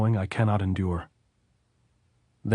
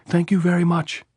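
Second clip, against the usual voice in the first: loud, higher cry through tears; choking shout; 10 semitones higher, 5 dB louder than usual. A narrator's reading. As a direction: push it out as a sob-shout. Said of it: "then choking again".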